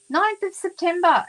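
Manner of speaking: Ninth of September thrown high and loud in disbelief; incredulous repeat. Real, not acted. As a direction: stress Ninth, amazed it took so long.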